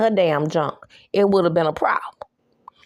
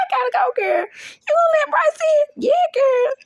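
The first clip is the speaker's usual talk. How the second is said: put-on much higher, fast, girly chatter; high mock girlfriend voice; 4 dB louder than usual. Recapping the show, she voices the gossiping girlfriends: high and giddy. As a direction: squeal it out like excited girlfriends.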